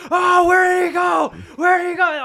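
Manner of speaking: high, very loud drawn-out wail; screaming baby. Retold or imitated. Baby during peekaboo with mum, imitated full-volume scream. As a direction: scream it like a baby losing sight of someone. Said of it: "and he just screams".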